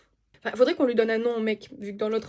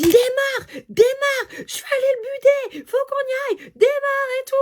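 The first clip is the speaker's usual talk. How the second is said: much higher, louder frantic shouting; retold furious yell; 13 semitones higher, 7 dB louder than usual. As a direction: yell at her to start the car, ready to fight. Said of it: "il me recrie dessus".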